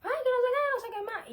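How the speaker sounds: high mock yell from the husband; retold yell. Husband yelling at her through the door, mock high shout. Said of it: "y me grita".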